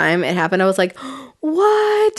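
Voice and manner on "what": sharp gasp into a high astonished what; gasp of surprise. Retold or imitated. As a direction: replay the shock of the moment. Amazed at the Polyjuice transformation.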